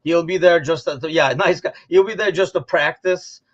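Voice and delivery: usual speaking voice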